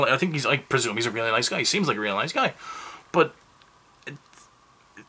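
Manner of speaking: ordinary delivery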